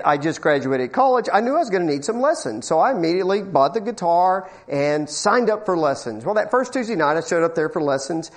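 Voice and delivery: ordinary talk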